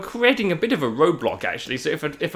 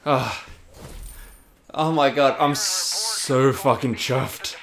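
slow savouring; much slower than usual. Real, not acted. Savouring the success.

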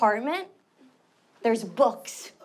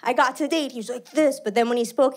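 usual speaking voice

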